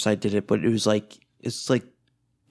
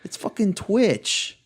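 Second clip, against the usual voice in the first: retold exasperation; 5 semitones higher than usual. Streamer recalling her reaction to a creepy tipper, exasperated. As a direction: act out your disbelief, voice swooping up. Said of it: "And I was just like".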